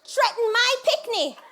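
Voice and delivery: loud, high, drawn-out threat ending in a shout; vengeful mother. Retold or imitated. Jamaican mother with machete avenging her child, furious. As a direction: declare war, then bellow the order. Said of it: "So she says".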